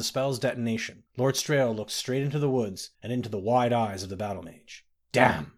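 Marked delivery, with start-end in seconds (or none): 5.1-5.5 curse. A narrator's reading. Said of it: "said Lord Errol".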